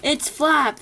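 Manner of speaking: high, loud joyful cry; excited cry. A narrator's reading. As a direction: call it out with delight. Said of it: "they cried out".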